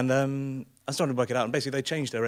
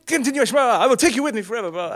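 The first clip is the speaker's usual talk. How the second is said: high, loud, garbled tirade; mock driver rant; 10 semitones higher, 8 dB louder, faster than usual. Bus driver threatening to take passengers away, ranting. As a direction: rattle off a fast mock threat.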